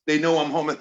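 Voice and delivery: ordinary delivery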